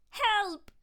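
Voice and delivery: high, loud panicked cry for help; shriek. A narrator's reading. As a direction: shriek as you're cornered. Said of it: "he shrieked".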